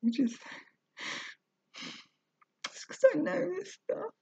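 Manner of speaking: high, tear-choked voice; words squeezed out through sobs; crying. Real, not acted. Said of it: "I'm crying".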